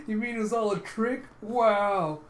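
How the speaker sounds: high, loud, impressed disbelief; hammed-up astonishment; acted amused amazement. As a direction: marvel at a friend's trick. Character laughs, amazed at the trick.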